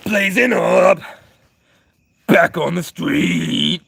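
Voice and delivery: loud, high shout with a long drawn-out ending; hammed-up yell. Streamer bursting out to chat, hammed-up drawn-out yell. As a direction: belt it out, stretching the last word. Sudden yelled catchphrase to chat.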